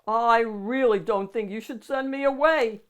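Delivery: loud monster warning. A narrator's reading. Monster warns the child not to send him away. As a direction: warn them, half-menacing. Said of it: "he warned".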